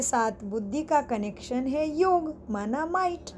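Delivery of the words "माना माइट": ordinary delivery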